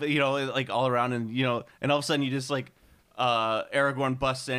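ordinary delivery

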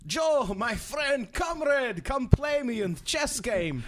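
hammed-up Russian comrade calling out; Russian accent. Grandmaster challenging Joe in a loud mock Russian accent. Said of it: "do it in a Russian accent".

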